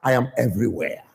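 hushed parental reminder on the way out; father's warning. Acted. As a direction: drop it quietly as a knowing threat. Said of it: "One of the things I tell Jesse".

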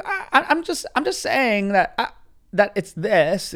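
high, stammering, mock-defensive voice; defensive justifying voice. Showing the voice the driver is doing, high defensive mimicry. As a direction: start way up high and stammer the excuse. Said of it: "That's the voice he's doing".

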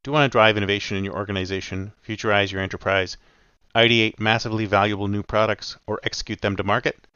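low, smooth ad read for the sponsor. Acted. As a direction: sell it like a podcast sponsor spot. Host reads sponsor ad.

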